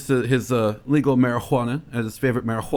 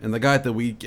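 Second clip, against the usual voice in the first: normal talking voice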